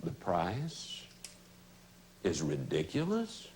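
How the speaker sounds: low, soft questioning echo with pauses. Shown as a demonstration. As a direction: drop your voice right down and ask it back gently. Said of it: "Just lower your voice".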